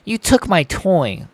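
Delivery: put-on slow, high whiny kid; angry child voice. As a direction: whine it like a pouting child. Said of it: "He looks like an angry child".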